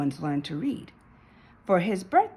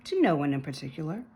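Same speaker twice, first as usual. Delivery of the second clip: plain reading voice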